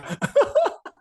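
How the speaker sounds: a short high burst of laughter. Real, not acted. Laughs at joke with coach. (tawa tinggi yang singkat)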